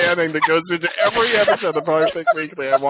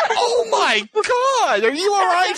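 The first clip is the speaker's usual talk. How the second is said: much higher, very slow alarmed cry; mock freak-out; 14 semitones higher, slower than usual. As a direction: play up the panic over your friend. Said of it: "Ryan totally freaks out".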